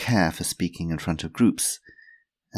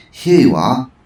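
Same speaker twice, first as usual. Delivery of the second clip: put-on higher, louder old lady; Missus Twistleton; 7 semitones higher, 13 dB louder than usual. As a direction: hand it over brightly.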